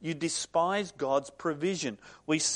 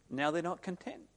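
ordinary talk

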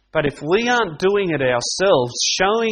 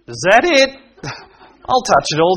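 higher and louder, laughing through it; laughing cheeky child; 4 semitones higher, 5 dB louder than usual. Retold or imitated. Child mocks weak punishment.

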